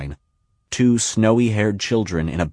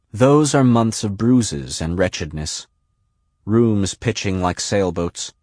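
usual speaking voice

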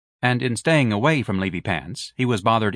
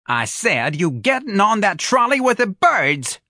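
much higher, slow, shrill-sounding yell; screamed; 11 semitones higher, 5 dB louder, slower than usual. Acted. Mother screams at her absent-minded son, high and slow. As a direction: scream it at him across the room. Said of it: "Missus Riley screamed".

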